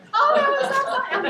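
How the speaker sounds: high, loud laughing burst. Retold or imitated. A client retelling her scooter fall, laughing hard.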